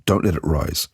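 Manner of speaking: ordinary delivery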